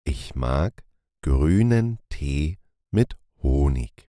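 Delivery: usual speaking voice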